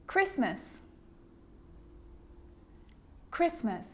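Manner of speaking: "Christmas" as KRISS-muss, no T; careful, separate models of "Christmas"; silent T. Shown as a demonstration. Teacher showing the sneaky T, careful repeated model. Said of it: "it can also be silent".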